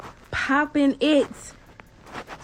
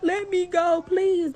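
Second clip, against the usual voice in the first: mock pleading scream; 7 semitones higher, 4 dB louder, much slower than usual. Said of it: "You could hear her screaming".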